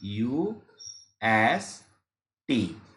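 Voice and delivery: UST drawn out very slowly, each letter lengthened; slowly stretched letters. Shown as a demonstration. Teacher slowly draws out UST for students. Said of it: "अब UST को आप क्या पढ़ेंगे?".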